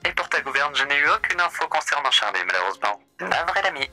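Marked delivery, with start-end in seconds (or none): none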